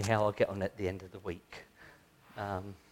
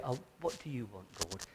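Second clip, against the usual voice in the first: hushed prayer; 9 dB quieter, faster than usual. Retold or imitated. Speaker voices own prayer.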